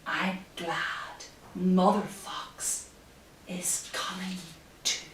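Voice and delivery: quiet, hushed reading to little ones; hushed picture-book narration; soft storytime reading. Acted. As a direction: read it gently like a bedtime story. Reading story to children softly.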